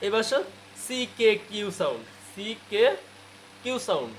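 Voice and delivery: usual speaking voice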